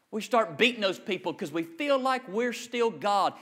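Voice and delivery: ordinary talk, nothing marked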